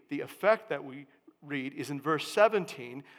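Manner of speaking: normal talking voice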